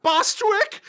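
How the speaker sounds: very high falsetto call; high singy mock screech; cartoon old ladies. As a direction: call out like a dotty old lady. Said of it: "the old ladies go like".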